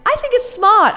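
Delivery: ordinary talk, nothing marked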